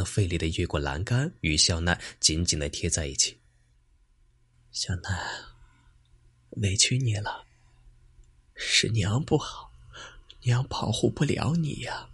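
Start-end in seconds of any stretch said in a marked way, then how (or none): 4.7-12.1 sorrowful. A narrator's reading. Old mother grieves to tree.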